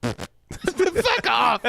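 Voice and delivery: ordinary talk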